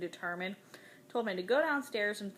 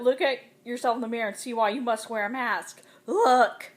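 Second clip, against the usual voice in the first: furious shout; 3 semitones higher, 10 dB louder than usual. A narrator's reading. Mother forces son to mirror.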